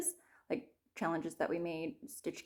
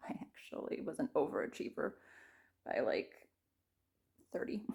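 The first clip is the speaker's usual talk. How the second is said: whispering; 5 semitones lower, 6 dB quieter than usual. Real, not acted. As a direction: whisper it like a secret. Whispered aside about stitches.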